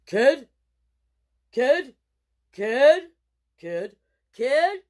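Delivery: booming bear calling, loud; a big bear bellowing for the kid; deep bear voice. Acted. Bear calling after the boy, booming loud. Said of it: "saying in a deep voice".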